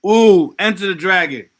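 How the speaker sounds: light up at the suggestion; excited. Real, not acted. Streamer reading chat's Enter the Dragon pick, loud and delighted.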